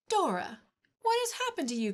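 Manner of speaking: high, startled outcry; alarmed cry. Acted. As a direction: cry out in shock at the sight. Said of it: "cried Anne".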